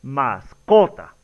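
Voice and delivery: "mascota" slow, CO stressed, then falling; deliberate, confirming "masCOta"; correct stress on CO. Shown as a demonstration. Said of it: "la pronunciación correcta es".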